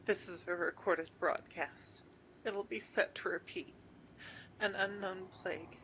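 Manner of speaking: soft, low, weary message; quiet recorded message. Acted.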